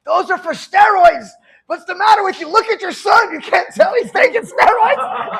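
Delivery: loud, high-pitched imitation of outrage; exasperated shouting. Dad's friend tells parents about steroids, shouting exasperated. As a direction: shout in mock disbelief, stretching key words.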